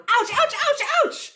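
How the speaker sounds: high, loud string of yelps; hammed-up storybook pain. A narrator's reading. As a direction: yelp at each peck. Character being pecked by chickens.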